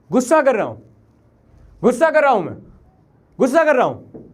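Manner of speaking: loud, high angry yells; angry outburst. Real, not acted. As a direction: shout it in separate bursts. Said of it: "मुझे थोड़ा गुस्सा आ गया".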